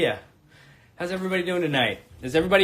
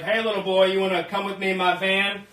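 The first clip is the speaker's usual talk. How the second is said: put-on creep luring a child; lightly voiced creepy stranger coaxing a kid. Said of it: "some creep to be like".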